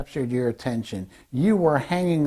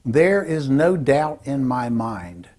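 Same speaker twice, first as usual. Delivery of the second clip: slow, deliberate power phrase; definitive; slower than usual. Shown as a demonstration. Speaker coach models a power phrase for learners, slow and definitive. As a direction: state it slowly, as beyond question. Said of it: "hear what they sound like".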